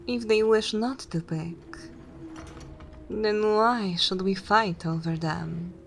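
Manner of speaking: measured, emphatic vampire making a case; deliberating vampire. Acted. Vampire proposing to rival that they share a thrall, deliberate and emphatic.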